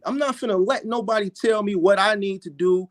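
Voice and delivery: ordinary talk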